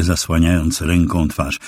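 plain reading voice

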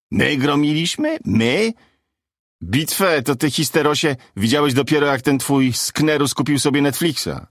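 mock the rival's boast with indignation; scornful retort. Angel's scornful retort in quarrel.

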